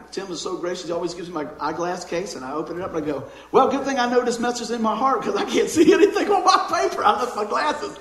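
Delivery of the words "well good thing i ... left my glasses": high, loud, laughing self-mockery; laughing mock exclamation. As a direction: joke loudly at your own mishap, voice jumping up. Jokes about forgotten glasses.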